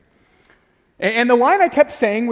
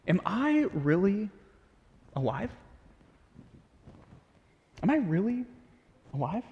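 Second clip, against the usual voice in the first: quiet, searching, lingering on really; hushed searching question; soft inner question; 8 dB quieter than usual. Retold or imitated. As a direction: ask it softly, almost to yourself. Inner question, voiced softly.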